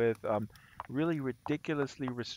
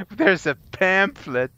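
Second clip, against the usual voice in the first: mock solemn; 7 semitones higher, 10 dB louder, slower than usual. Mocking pamphlet, slow and loud.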